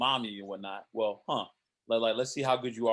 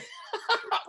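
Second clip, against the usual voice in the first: burst of laughter. Real, not acted. Laughing at own joke.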